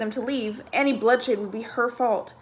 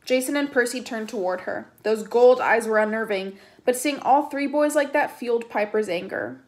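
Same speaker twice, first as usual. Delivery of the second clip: ordinary delivery, nothing marked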